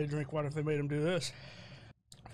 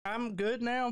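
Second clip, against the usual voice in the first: ordinary talk, nothing marked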